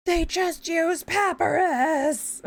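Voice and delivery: high, slow, drawn-out outraged cry; hammed-up anguished wail; mock freak-out. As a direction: imitate someone losing it over a font, dragging every word. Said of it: "he's, like, freaking out".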